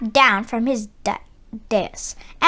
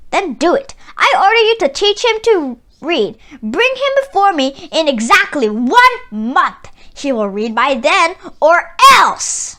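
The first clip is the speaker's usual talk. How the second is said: loud, high, imperious order; commanding tyrant; 4 semitones higher, 6 dB louder than usual. A narrator's reading. Tyrant orders Nasreddin to teach the donkey, loud and imperious. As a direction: lay down the command like a despot.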